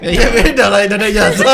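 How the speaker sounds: loud, high, laughing comeback; laughing retort. Real, not acted. Guest joking back at teasing classmates, loud laughing retort. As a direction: fire back loudly through laughter.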